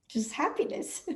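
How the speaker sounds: soft, airy exhale with a lift; breathed-out happiness. Shown as a demonstration. Speaker showing a sudden moment of breathing happiness, airy and soft. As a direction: breathe the words out softly, contentedly. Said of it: "then I breathe happiness".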